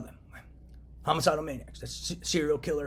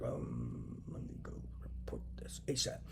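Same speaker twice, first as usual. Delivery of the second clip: whisper; 11 dB quieter, slower than usual. Retold or imitated. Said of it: "I was like".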